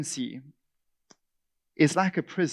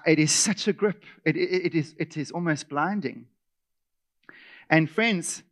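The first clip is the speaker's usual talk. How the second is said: normal talking voice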